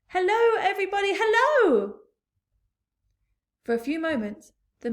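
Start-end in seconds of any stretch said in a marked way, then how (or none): 0.1-2.0 high, loud cheerful call. A narrator's reading. Boy James calling down to the crowd, cheerful. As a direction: wave and shout hello. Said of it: "laughing and waving and calling out".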